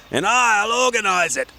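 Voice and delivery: high, bossy little gnome; cheerful small gnome with a plan; Gordon the gnome. Acted. Gordon voiced in story.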